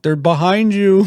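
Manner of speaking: high, loud robot sidekick warning; C-3PO. Retold or imitated. Droid on Chewie's back warns him, high mock alarm. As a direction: imitate an alarmed droid calling out. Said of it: "C three P O is, like, telling him".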